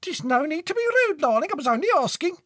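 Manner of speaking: very high, screechy reproach; mum's piercing, offended voice; affronted shrieking mother. Acted. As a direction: call back huffily from downstairs.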